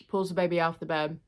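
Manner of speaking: usual speaking voice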